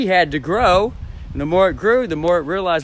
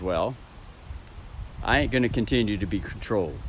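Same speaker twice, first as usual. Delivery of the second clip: mother answering; 5 semitones lower, 10 dB quieter than usual. Retold or imitated. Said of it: "looks at the child and says".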